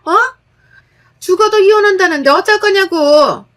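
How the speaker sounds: high, loud angry scolding; shrill-free loud angry rant from the mother-in-law. A narrator's reading. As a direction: berate her like a furious mother-in-law. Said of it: "욕 한 바가지를 먹었습니다".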